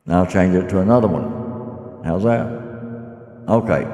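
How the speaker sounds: booming echo from the voice changer; cave echo. Real, not acted. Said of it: "does it sound like I'm in a cave?".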